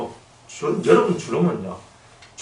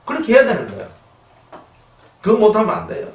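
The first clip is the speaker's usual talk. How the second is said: louder, higher challenge to the flock; preach it loud; 8 semitones higher, 4 dB louder than usual. Real, not acted. Pastor loudly challenges the congregation, louder. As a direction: shout the challenge.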